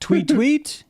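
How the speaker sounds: high chirpy tweet tweet; bird tweets. Retold or imitated. Explaining bird language to the co-host, high chirpy tweets. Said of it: "canary-ish kind of language".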